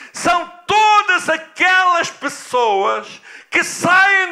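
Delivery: loud, high, drawn-out preaching; pregação enfática. Real, not acted. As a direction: preach it forcefully, stretching key words. (pregação enfática, alta e arrastada)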